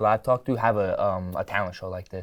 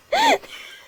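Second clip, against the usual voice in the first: high squealing laugh; 5 dB louder than usual. Real, not acted. Laughing while eating cookies.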